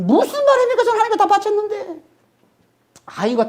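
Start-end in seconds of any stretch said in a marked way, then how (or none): 0.0-2.1 Saul's protest. Retold or imitated. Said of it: "그랬더니".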